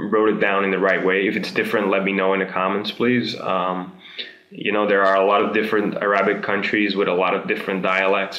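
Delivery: ordinary talk, nothing marked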